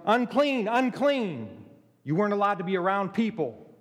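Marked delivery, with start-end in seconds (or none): 0.1-1.7 a raised warning cry, pitched up; calling out unclean. Retold or imitated. Leper warning townsfolk off with a loud cry. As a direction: call it out to keep people away. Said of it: "you just yelled".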